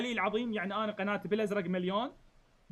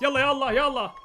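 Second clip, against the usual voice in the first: urging call; 5 semitones higher, 7 dB louder than usual. Acted. Commander urging troops to move.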